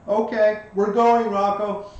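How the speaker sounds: put-on high, loud child's voice; bossy little girl eager to set off; excited little girl. Seven-year-old tugging Rocco away by the hand, excited child. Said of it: "And she's like".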